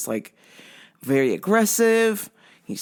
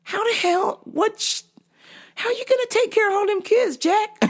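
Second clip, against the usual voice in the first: high-pitched incredulous question; amused disbelief; 12 semitones higher than usual. Real, not acted. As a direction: squeak it out, baffled and tickled. Incredulous, still laughing about the news.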